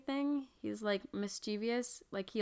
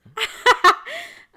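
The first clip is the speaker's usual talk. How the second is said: loud laugh; 12 dB louder than usual. Real, not acted. Burst of laughter.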